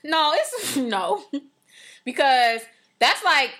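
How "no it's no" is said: ordinary talk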